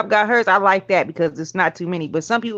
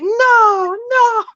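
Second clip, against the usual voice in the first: horrified no. Retold or imitated. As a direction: shriek it as he backs away. Guy refuses to dance.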